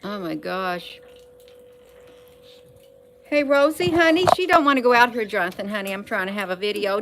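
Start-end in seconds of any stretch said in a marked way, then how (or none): 3.2-5.2 higher, louder pet-directed voice; raised, high-pitched call to a pet. Real, not acted. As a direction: call out sweetly to the dog. Calling to pet Rosie mid-video.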